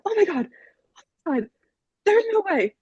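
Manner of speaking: high-pitched mock scream, not full volume; retold freak-out. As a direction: squeal it in disbelief, reenacting the moment. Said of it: "I am now yelling, screaming".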